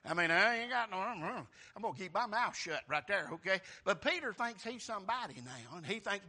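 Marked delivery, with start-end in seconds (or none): none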